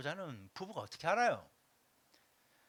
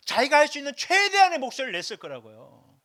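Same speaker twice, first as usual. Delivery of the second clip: high, loud full-force cry; loudest voice; 7 semitones higher, 11 dB louder than usual. Acted. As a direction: preach it at the top of your lungs.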